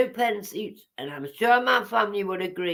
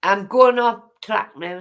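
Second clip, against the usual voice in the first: plain reading voice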